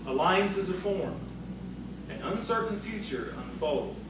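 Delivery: ordinary delivery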